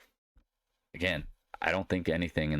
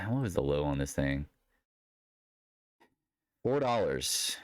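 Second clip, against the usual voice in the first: ordinary talk, nothing marked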